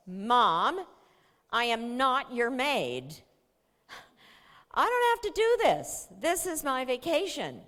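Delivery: put-on high, loud indignant teenager; loud, high defiant daughter; angry teen outburst. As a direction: snap back at mom, outraged.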